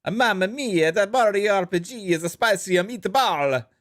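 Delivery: hammed-up Italian accent, pitched well up; sing-song mock Italian, high and excitable. Streamer obliges a viewer's super chat request, hammed-up Italian accent. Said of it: "Please, in an Italian accent".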